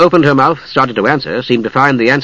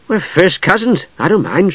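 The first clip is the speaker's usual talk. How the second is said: higher-pitched, lightly reassuring voice; smiling; 7 semitones higher than usual. A narrator's reading. Usha reassuring Palin about being cousins, smiling. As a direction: say it with a smile, pitched up. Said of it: "and she smiled at him".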